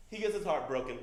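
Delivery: ordinary talk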